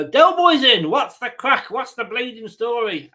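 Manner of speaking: put-on playful stab at an Irish accent; attempted Irish accent. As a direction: greet him in a bad Irish accent. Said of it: "I can't do an accent".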